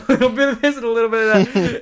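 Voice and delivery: real laughter bubbling through the words; laughing. Real, not acted. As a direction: laugh while riffing on the phrase. Laughing riff on friend's line.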